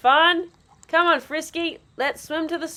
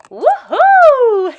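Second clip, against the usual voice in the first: excited whoop; 7 semitones higher, 10 dB louder than usual. Acted. Sliding down the slide.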